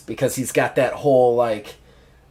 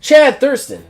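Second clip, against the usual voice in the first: high, loud call of the name; interviewer's call; 10 semitones higher, 5 dB louder than usual. Acted. Interviewer calls the champion's name, high and loud. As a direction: announce him like an eager backstage interviewer. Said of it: "Jeffrey Chimes like".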